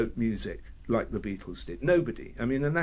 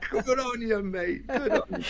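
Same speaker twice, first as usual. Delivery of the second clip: higher, hearty praise; cheery Aussie mate; 10 semitones higher than usual. Retold or imitated. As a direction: slap him on the back with words. Voicing rivals congratulating swimmer.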